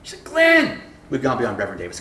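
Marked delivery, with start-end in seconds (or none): 0.2-0.8 high, loud name call; excited phone greeting. Retold or imitated. Professor phoning the preacher, excited call.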